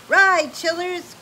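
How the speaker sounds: loud, high, drawn-out rallying call; rally the kids to flee; urgent cry. Acted. Grand Dude urging the children away from the stampede, loud. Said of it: "cried Grand Dude".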